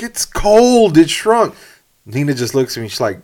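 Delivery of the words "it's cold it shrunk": high, loud defensive protest; George from Seinfeld. Retold or imitated. Sitcom man caught out of the pool, high defensive protest. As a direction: whine the excuse loudly, pitched way up.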